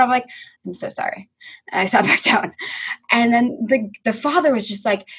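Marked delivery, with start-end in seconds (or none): 0.7-1.3 hushed, low little apology; retold sheepish apology. Fan apologizing to pitcher's family behind her, sheepish. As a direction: drop to a small, soft sorry.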